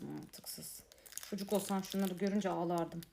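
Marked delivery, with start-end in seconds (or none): 0.0-0.8 whispered. Real, not acted. Disappointed by toy.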